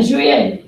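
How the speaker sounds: juillet said as zhwee-yay, no L sound; careful French. Shown as a demonstration. Teacher models juillet as zhwee-yay for students. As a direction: model the word juillet clearly for the class. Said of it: "So listen again".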